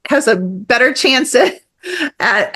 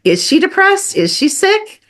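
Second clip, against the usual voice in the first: asked higher, like querying a device; higher questioning voice; 9 semitones higher than usual. Pretend. Speaker voicing someone asking a device about mom, higher. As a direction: float the questions up.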